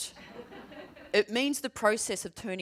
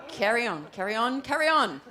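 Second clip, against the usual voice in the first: embarrassed brush-off; 5 semitones higher, 5 dB louder than usual. Retold or imitated. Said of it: "I was like".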